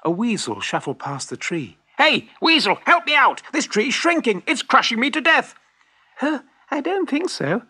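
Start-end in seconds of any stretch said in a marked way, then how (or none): 2.0-5.6 high, loud plea from a trapped fox; frantic calling out. A narrator's reading. Trapped fox calls to passing weasel. 6.2-7.6 high, scoffing little-animal voice; amused, dismissive squeak of a small weasel; laughing little weasel. A narrator's reading. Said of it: "laughed the little weasel".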